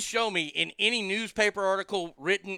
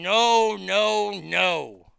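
mock loud no; 6 dB louder, much slower than usual.

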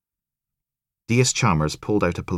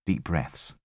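quietly steady your nerves; hushed reminder to breathe; telling himself to breathe; 2 semitones lower, 6 dB quieter than usual. Acted.

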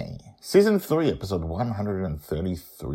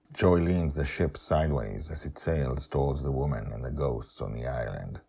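ordinary delivery, nothing marked

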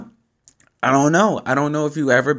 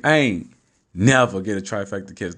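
ordinary talk

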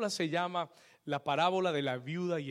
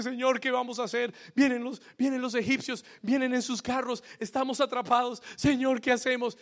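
anxious Moses; 7 semitones higher, 5 dB louder than usual. Retold or imitated. Moses pleading to God.

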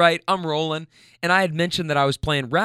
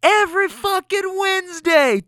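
yelled out. Retold or imitated. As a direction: shout it to everyone. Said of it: "she yells out of the car".

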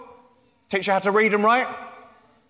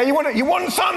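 mock taunt; 9 semitones higher than usual. Taunting the crowd mock-fight.

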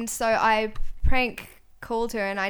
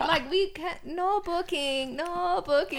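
high-pitched bad broken-English accent; halting mock broken-English accent on a prank call; terrible broken-English accent; 7 semitones higher, 5 dB louder, slower than usual. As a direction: put on a clumsy foreign accent, pitched up. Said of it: "It was the worst accent".

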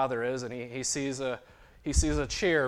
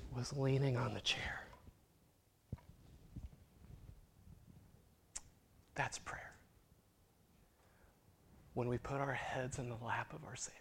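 hushed tender whisper; 11 dB quieter than usual. Acted. Preacher ending the story to the church, whispered. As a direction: breathe it, barely voiced.

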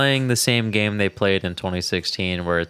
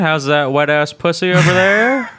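mock candidate greeting; 6 semitones higher, 4 dB louder than usual. Host imagines the candidate interviewing [name], hammed-up greeting. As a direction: ham up an awkward celebrity greeting.

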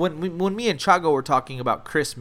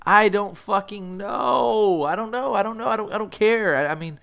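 exasperated; 6 semitones higher than usual. Retold or imitated. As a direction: throw up your hands, pitched way up. Exasperated self-quote to mom.

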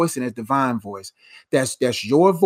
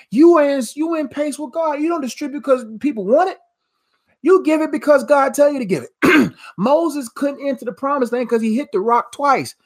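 preaching at full force; 9 semitones higher than usual. Acted. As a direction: pitch it up and drive the point home. Preacher climaxes, much higher pitch.

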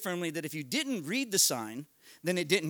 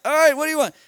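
high, loud burst; loud, worked-up retort; exasperated giving in; 8 semitones higher, 12 dB louder, faster than usual. Retold or imitated. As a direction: throw up a loud surrender to the robber. Exasperated reply to yelling robber.

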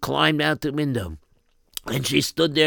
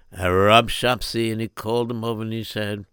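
plain reading voice

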